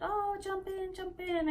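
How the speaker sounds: high-pitched mock coaxing voice; sing-out peer-pressure impression; egging on. Onlookers egging her on, retold.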